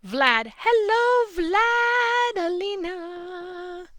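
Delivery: high, loud sing-song hello, names stretched out; calling out a cheerful welcome to arriving viewers; excited greeting. Real, not acted. Host greets live-chat viewers Vlad and Alina, excited high call.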